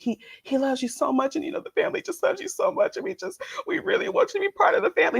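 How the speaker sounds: high, fast, syrupy sales pitch; pushy sweet mom. Retold or imitated. Imitating the mom pressuring the bride, high and fast. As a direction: sweet-talk her into the family, quickly. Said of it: "trying to put some light pressure on her".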